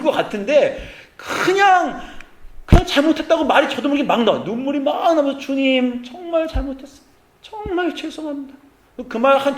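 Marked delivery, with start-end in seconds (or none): 5.4-8.6 high, choked cry of repentance; tearful pleading prayer. Retold or imitated. Pastor weeping before God, high and pleading. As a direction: cry out sorry through tears. Tears flowing, crying out to God.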